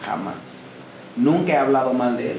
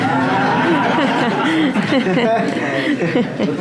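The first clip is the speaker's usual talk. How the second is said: a loud, genuine burst of laughter; hearty real laugh; 7 semitones higher, 4 dB louder than usual. Real, not acted. Laughing at interjection from co-pastor.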